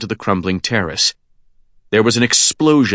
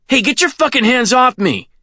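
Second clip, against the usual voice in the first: high, indignant yell at someone grabbing you; angry shout; 14 semitones higher than usual. Acted. Kenny yells at the old man gripping his arm, angry shout. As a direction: protest at a much higher pitch.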